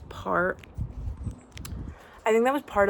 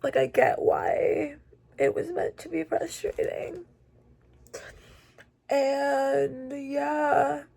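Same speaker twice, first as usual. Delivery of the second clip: tearful; 6 semitones higher, much slower than usual. Real, not acted. Tearful after finishing book.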